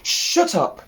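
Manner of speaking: sharp high snap at the sheep; barked. Acted. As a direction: bark it out like a cross sheepdog. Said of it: "she barked".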